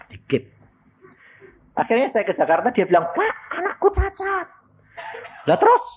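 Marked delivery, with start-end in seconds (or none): 3.1-4.5 very high wailing plea; distressed plea. Retold or imitated. Parent wailing to the preacher. 5.4-5.8 high, exclaimed retort; brusque retort. Retold or imitated. Preacher answering the worried parent, brusque retort.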